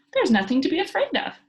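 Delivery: high, loud and playful; a carefree child calling out to scared men; gleeful cry. A narrator's reading. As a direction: call down from the roof teasingly. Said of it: "cried Pippi".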